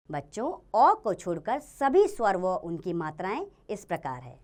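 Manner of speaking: normal talking voice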